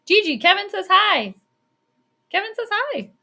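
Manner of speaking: high, lilting pet-talk; calling the dog. Real, not acted. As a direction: call out high to a sleepy dog. Calling to her sleeping dog.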